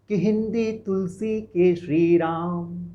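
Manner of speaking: ordinary talk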